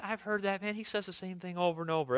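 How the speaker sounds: soft, quickening mock complaint; imitated grumble. Pastor imitating someone in this room, quietly grumbling. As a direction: grumble it under your breath as a listener would. Imagined congregant grumbling about repetition.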